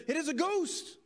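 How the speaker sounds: high, mildly alarmed exclamation while reading; crying out in fear. A narrator's reading. Disciples seeing him walking on the sea, crying out in fear. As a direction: read it as a startled, fearful cry. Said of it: "they cried out in fear".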